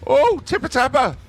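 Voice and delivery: sudden high, loud cry of delight; excited outburst. Real, not acted. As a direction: jump up and shout it out. Caster reacts to a hit.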